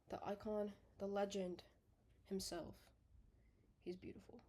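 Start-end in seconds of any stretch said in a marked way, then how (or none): 3.8-4.4 soft breathy whisper of love; tender whisper. Real, not acted. A girl whispering lovingly over her old plush toy.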